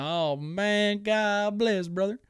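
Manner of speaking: high, bright "God bless brother"; mock fan cheer. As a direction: cheer the fight like a delighted fan. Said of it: "I'm like".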